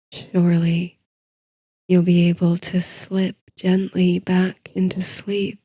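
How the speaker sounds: usual speaking voice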